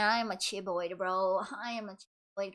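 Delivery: talking around a pencil in the mouth; speaking with a pencil clamped in the teeth. Shown as a demonstration. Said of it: "if I speak with a pencil in my teeth".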